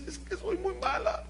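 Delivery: put-on high, soft crying little girl; tearful child. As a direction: whimper it as a guilty child. Child repeats her guilt.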